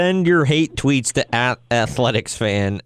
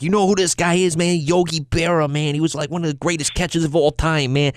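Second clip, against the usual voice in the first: higher-pitched imitation of an excited fan; super Yankee fan; 5 semitones higher than usual. Host imitating a Yankee fan lecturing a little kid, fervent fan impression. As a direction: gush about a baseball hero, mock die-hard. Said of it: "the super Yankee fan".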